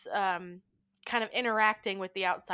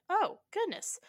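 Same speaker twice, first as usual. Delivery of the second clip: much higher, delighted; charmed; 11 semitones higher than usual. Retold or imitated. Emma enchanted by Maggie's toddler, charmed. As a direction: coo over the little boy. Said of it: "she's like".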